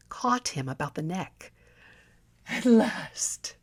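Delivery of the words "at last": dying gasp. Acted. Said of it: "she cried".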